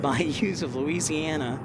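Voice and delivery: ordinary delivery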